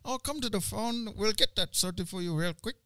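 high, soft mock Singaporean accent. Mocking how Singaporeans speak English, high Singaporean accent. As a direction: put on a lilting Singaporean accent. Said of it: "that's not proper English".

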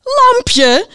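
very high, loud incredulous yell; shouting. A narrator's reading. Knight hears the winner, shouting in disbelief. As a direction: shriek the name back in disbelief. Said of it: "riep de ridster".

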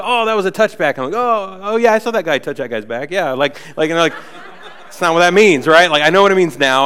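ordinary talk, nothing marked

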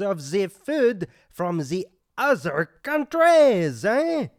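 hammed-up French waiter, rising eh on every line; thick mock French accent, eh after each line; comic French accent. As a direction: play the offended French waiter.

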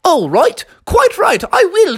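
high, put-on English accent; mock English accent. Froggy defying the nanny, mock English accent. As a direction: play at being properly English. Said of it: "talk in an English accent also".

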